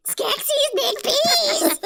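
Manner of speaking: high, loud, whimpering wail; crying Chamberlain. Retold or imitated. Imitating the Chamberlain's loud crying plea. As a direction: wail for peace, half sobbing. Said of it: "his voice just cries".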